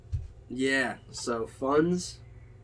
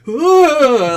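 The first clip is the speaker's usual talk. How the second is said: high, loud retold yelp of fright; hammed-up fright underwater; startled whoa; 12 semitones higher, 16 dB louder than usual. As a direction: relive the shock of the eel in a loud high yelp. Startled by eel in water.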